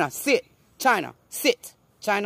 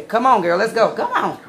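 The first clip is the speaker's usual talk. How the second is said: louder, firm call with a laugh in it; commanding voice; 5 semitones higher, 5 dB louder than usual. Shown as a demonstration. Owner showing how to order the dog outside, commanding. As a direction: take charge of the dog with authority. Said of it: "You gotta have authority over here like this and say".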